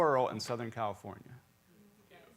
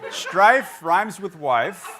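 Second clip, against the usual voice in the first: laughing; 6 semitones higher, 10 dB louder, much slower than usual. Real, not acted. Laughing at the joke.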